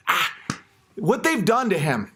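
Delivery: ordinary delivery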